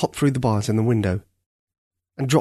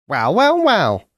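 put-on high, drawn-out old crow voice; a wise old crow calling out; raspy cawing crow; 8 semitones higher, 5 dB louder, slower than usual. A narrator's reading. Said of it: "said the senior crow".

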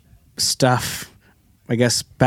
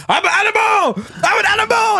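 very high, strained mock shout; psyched-up yell. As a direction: yell it like you're hyping yourself into a stunt. Acts out psyched-up movie character.

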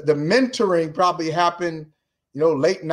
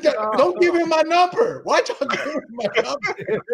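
higher-pitched, hammed-up complaint; mock exasperated scolding; 10 semitones higher than usual. Columnist retelling how he scolded the Globe desk, mock exasperated. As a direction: playfully scold the news desk.

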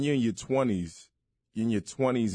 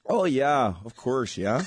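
higher, chipper Canadian; eager Canadian impression; Canadian accent; 3 semitones higher, slower than usual. Shown as a demonstration. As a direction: agreeable Canadian with a lifted tag at the end. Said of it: "Can you do a Canadian accent?".

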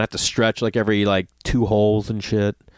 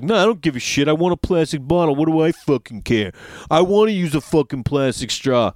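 mock careless jerk; 3 semitones higher than usual. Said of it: "They're like".

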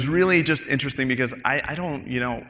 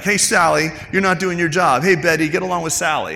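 mock boss scolding; 5 semitones higher, 5 dB louder than usual. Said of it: "then I come back".

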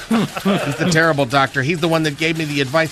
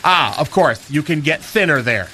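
ordinary talk, nothing marked

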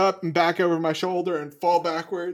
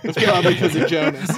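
repeating the joke through real laughter; laughing echo; 4 dB louder than usual. Real, not acted. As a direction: giggle it back at the table. Player echoes joke laughing.

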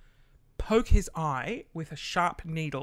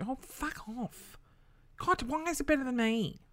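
playfully whining at mum's better painting; mock exasperation; 8 semitones higher, 4 dB quieter than usual. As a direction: groan it out in mock frustration. Retold envy at mum's art.

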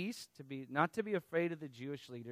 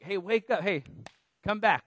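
raised, higher wake-up call; calling someone back; 6 semitones higher, 8 dB louder than usual. Retold or imitated. To somebody too overexcited, calling them back to reality loudly. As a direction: get their attention, loud and high.

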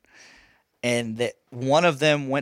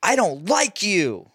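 put-on slow, higher childish whine; imitating a whiny kid; 6 semitones higher, slower than usual. Dad imitating his stepson's whiny comeback, slow and higher. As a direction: mimic a pouting five-year-old. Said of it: "this is his thing ... he goes".

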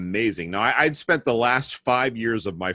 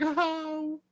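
high, soft cooing "oh"; imitated teacher melting over a cute child; gushing teacher; 6 dB quieter than usual. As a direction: coo as if at something adorable. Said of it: "my teacher thought I was the most, the cutest thing ever".

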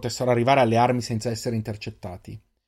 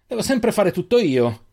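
out of breath; 8 semitones higher than usual. A narrator's reading. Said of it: "disse Firan senza fiato".

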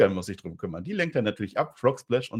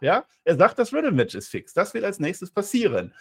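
ordinary talk

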